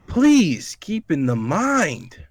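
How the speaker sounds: high, very slow, swooping emphatic read; all-caps exclamation. Acted. Rereading the all-caps last line, emphatic exclamation. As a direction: draw it out grandly with big pitch swoops. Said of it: "this is in all caps".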